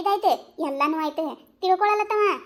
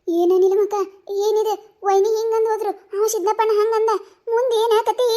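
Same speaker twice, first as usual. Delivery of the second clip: louder, higher, calling out in alarm; loud alarmed question; 4 semitones higher, 5 dB louder than usual. Acted. Asks Neelamma loudly and anxiously what happens next.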